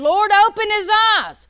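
high, loud pleading prayer; prophet's prayer. Retold or imitated. Prophet praying for his servant, high loud plea. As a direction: cry out to the Lord to open his eyes. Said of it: "he prayed and he said".

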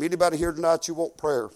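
ordinary talk

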